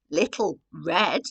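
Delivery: ordinary delivery, nothing marked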